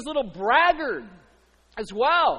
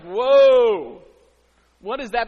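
a loud, taken-aback whoa; startled dad; 11 dB louder, much slower than usual. Retold or imitated. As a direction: react like a stunned father. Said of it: "even dad who loves him is like".

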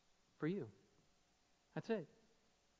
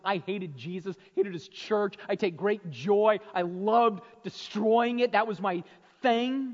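loud, high, driving sermon voice; fired-up pulpit delivery; full-force preaching; 6 semitones higher, 9 dB louder, slower than usual. Acted. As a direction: preach it out to the back row. Preaching voice rises again.

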